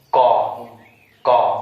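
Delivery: कॉ repeated loudly with an open aw; कॉ said like "caw", firm and loud; drilled pronunciation. Shown as a demonstration. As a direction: drill कॉ for a class, each one separate. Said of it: "बोलो बार बार कॉ".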